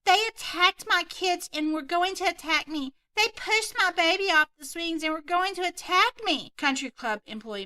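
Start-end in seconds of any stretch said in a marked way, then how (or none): 0.0-6.5 entitled Karen. Acted. Karen lying to employee.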